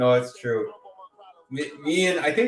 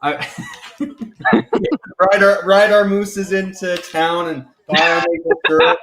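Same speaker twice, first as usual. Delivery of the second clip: higher, louder, breaking into laughter; laughing Canadian joke; 3 semitones higher, 5 dB louder than usual. Pretend. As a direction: crack up while joking about your own country. Joking Canadian stereotype, laughing.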